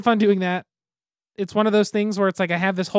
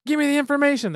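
higher pushy demand; mock interrogator; 8 semitones higher than usual. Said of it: "it's like".

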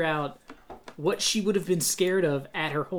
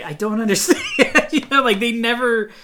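loud, higher, breaking into laughter; laughing exasperation; 6 semitones higher, 8 dB louder than usual. Real, not acted. Critic fed up with an unexplained plot, laughing exasperation. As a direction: protest through a baffled laugh.

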